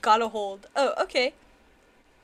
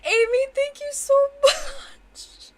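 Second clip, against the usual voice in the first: overwhelmed laughing thanks; 12 semitones higher, 6 dB louder than usual. Real, not acted.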